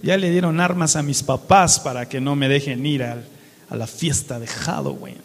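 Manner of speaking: low, breathy, rushed grumble; mock sulky teen. Preacher imitating youths grumbling about missing Halloween, breathy and low. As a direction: mumble like a teen who'd rather be partying. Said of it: "Y dicen los jóvenes".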